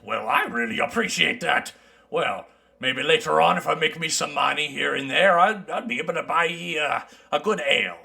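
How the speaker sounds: lightly voiced mountain dwarf; easygoing dwarf traveler.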